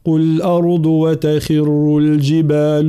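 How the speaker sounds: slow melodic tajweed chant; Quran recitation. Acted. Teacher recites. (تلاوة مجودة)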